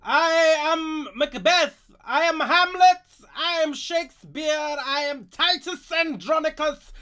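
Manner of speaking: loud, high theatrical proclamation; grand stage actor. Acted. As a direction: declaim like a ham Shakespearean actor. Hammy Shakespearean actor goose.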